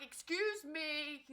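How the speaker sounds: pushy passenger squeezing past; pointed excuse me. Retold or imitated. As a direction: stretch it out sarcastically. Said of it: "I'm like".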